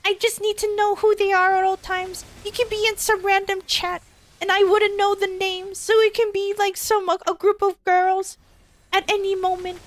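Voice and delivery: high whiny mock girlfriend. Mocking the jealous girlfriend's demands in a high whiny voice. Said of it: "she would say".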